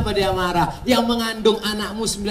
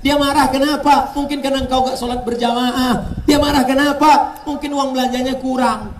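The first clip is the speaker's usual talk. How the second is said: emphatic preaching; 5 semitones higher, 4 dB louder than usual. Acted. Preaching rhetorical questions.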